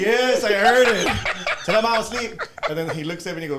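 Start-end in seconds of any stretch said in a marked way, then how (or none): 0.0-1.6 loud, high, drawn-out laughing reply; slow words bursting through laughter. Real, not acted. Cracking up at the punchline. 1.6-2.4 loud, high mimicked holler; raised voice, calling out an excuse. Repeats the called-out punchline.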